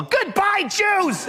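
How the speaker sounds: very high imitated child's yell; little girl yelling. Child actress performing her line at grown-ups, high yell. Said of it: "little cute girl who could yell".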